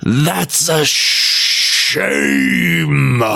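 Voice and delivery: very slow drawn-out hiss; hissing blizzard. Acted. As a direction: spit it out like a storm spirit. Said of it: "one of them hissed".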